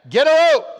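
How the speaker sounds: a rope yelled high and loud. Retold or imitated. Preacher topping his own quote of the ad, loud shout. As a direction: belt it out with gusto. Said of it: "that hot sauce commercial".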